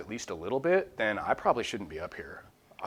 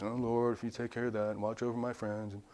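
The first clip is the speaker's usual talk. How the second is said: tepid prayer; 8 dB quieter than usual. Retold or imitated. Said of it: "very soft and tepid prayers".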